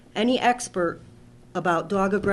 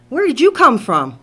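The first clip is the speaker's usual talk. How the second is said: louder startled question as the dog sitter; surprised dog sitter; 4 semitones higher, 12 dB louder than usual. Retold or imitated. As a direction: play the sitter caught off guard, louder. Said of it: "Here's the dog sitter going".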